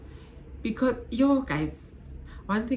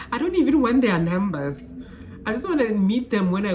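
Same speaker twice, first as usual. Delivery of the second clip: louder, higher, insisting; really mean it, raised voice; emphatic; 6 semitones higher, 5 dB louder than usual. Real, not acted. Telling viewers about friends' partners, louder and emphatic. Firm boundary rant.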